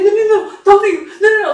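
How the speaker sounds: high, loud urgent begging; frantic pleading quote. Retold or imitated. Character holding on to him as he runs off, frantic. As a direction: act out clinging desperately. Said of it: "Like, holding on to him".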